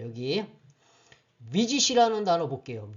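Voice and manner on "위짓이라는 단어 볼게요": usual speaking voice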